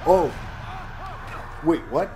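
sudden high surprised wait what. Real, not acted. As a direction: blurt it out in surprise. Sudden game twist.

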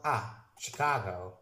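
usual speaking voice

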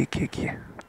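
soft whispered urging; whispering. Real, not acted. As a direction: whisper it, sneaking close. Player sneaks up on a target.

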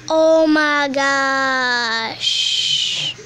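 very slow, stretched-out wail of shock; drawn-out shocked exclamation. Acted. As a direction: stretch every word out in theatrical shock. Drawn-out exclamation after a gasp.

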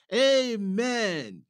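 loud, high shout of amen; the whole table shouting agreement; yelled. A narrator's reading. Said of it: "yelled everyone".

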